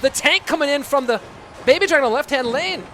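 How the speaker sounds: put-on Scottish accent. Caster reacting to a calamity in game, excited Scottish accent. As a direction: raise the call in a Scottish accent as damage lands. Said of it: "I have to go back to my American accent".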